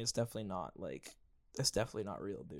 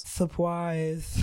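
imitating the camper; 6 semitones higher, 4 dB louder than usual.

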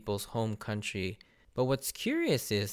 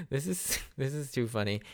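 laughing; 3 semitones higher, 4 dB quieter than usual. Real, not acted. Laughing at the anime scene.

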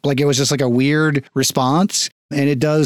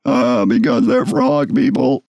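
downbeat frog man, slow; a sad little ribbit voice; 3 semitones lower, slower than usual. Acted. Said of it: "Feels like a very downbeat ribbit".

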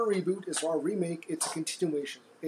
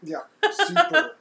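high loud super; mock enthusiastic; 13 semitones higher, 10 dB louder than usual. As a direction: shout super in jest. Mock emphasis on super ear.

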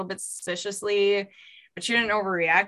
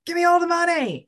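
high, loud put-on intruder's yell; loud demand; 10 semitones higher, 5 dB louder than usual. A narrator's reading. Storyteller as gunman robbing a couple, loud demand. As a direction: holler it like a cartoon robber. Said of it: "he demanded loudly".

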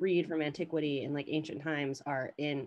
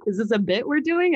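incredulous; 5 semitones higher, 8 dB louder than usual. Retold or imitated. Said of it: "I was definitely like".

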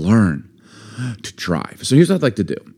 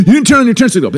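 high, loud whiny complaint; mock annoyed driver; 12 semitones higher, 5 dB louder, much faster than usual. Imitating a driver annoyed about turn signals, high and loud. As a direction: squeal it like an offended driver.

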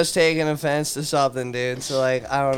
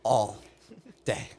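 ordinary talk, nothing marked

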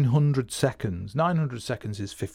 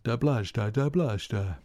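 imitated drum rhythm in syllables; drum speak. Shown as a demonstration. Drummer showing a familiar drum vibe, rhythmic drum speak. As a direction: chant the sticking pattern in time. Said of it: "Good bit of drum speak there".

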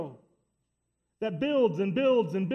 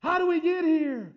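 impassioned cry; 6 semitones higher than usual. Acted. Preacher pleading with church not to drift, impassioned cry. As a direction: cry it out to the room.

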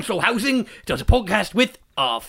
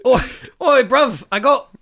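put-on British accent; 3 semitones higher than usual. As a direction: shout it like a cheeky Londoner. British character bit, high voice.

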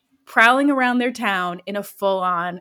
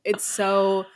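ordinary delivery

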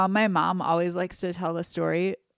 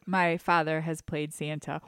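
ordinary talk, nothing marked

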